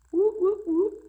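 very high sing-song whoop, whoop, whoop; playful whoop. Pretend. Teacher cheering the class on before diving in, high playful whoop. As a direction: chant it like a mock siren, way up high.

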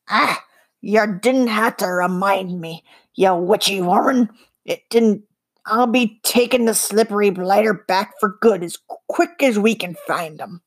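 slippery little goblin growling. A narrator's reading. Voices the goblin.

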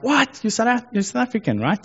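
high, delighted surprise at a fellow countryman; surprised. Retold or imitated. Recognizing a fellow South African in a UK shop, surprised. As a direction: hear a familiar accent and light up.

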